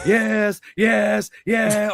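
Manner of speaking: loud, high, separate exclamations; imitated manic wrestler. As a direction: shout each word in mock triumph. Imitating wrestler staring down at him.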